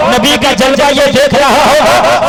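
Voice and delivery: loud, driving sermon cadence; full-force preaching. Acted. Preacher urging the gathering to listen, booming. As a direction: preach it hard to the crowd.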